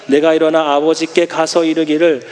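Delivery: low, measured Bible reading voice; preacher reading a verse to the congregation, low and measured; scripture reading. Real, not acted. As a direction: read the verse solemnly.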